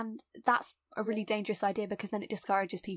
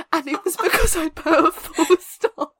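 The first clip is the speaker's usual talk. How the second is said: laughing disbelief; 6 semitones higher, 9 dB louder than usual. Real, not acted.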